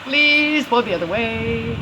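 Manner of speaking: high, drawn-out plea; pleading. Real, not acted. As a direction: beg the wind, stretching the words. Begging the wind.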